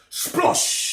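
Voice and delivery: loud whispered splash noise; splosh sound. Acted. Splash sound effect in story.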